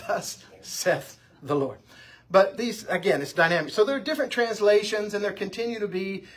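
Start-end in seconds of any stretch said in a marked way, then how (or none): 0.1-1.8 "saith" as one syllable, "seth"; slow model reading, "saith" rhyming with "Beth"; correct pronunciation. Shown as a demonstration. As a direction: recite it slowly with "saith" sounding like Seth. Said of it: "don't ever want to hear you say it wrong".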